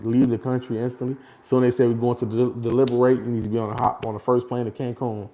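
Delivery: ordinary talk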